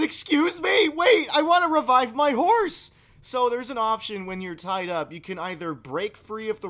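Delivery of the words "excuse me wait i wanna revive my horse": high, loud pleading protest; urgent raised-voice plea to captors. Retold or imitated. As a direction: cry out in desperate objection. Retold plea while tied up.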